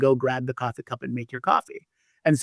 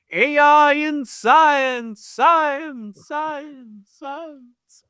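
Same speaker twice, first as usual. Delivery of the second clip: huge voice from the sky, fading echo; big booming echo voice; 13 semitones higher, much slower than usual. Acted. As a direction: boom it out and let it echo away. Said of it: "big booming echo voice".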